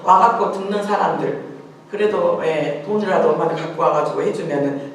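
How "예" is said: usual speaking voice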